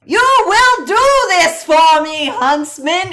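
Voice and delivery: high, loud dramatic command; high-pitched mock royal command; commanding huntsman quote. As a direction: boom it out like a grand queen giving orders. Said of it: "When you said".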